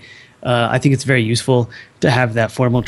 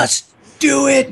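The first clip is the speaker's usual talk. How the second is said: hype cheer; 13 semitones higher, slower than usual. Real, not acted. Panel cheering each other on.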